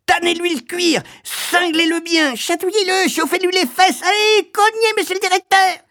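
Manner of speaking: frenzied, very high yelping; madame Pratchett. A narrator's reading. Woman egging on the headmaster, shrilly yelping. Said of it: "glapit madame Pratchett".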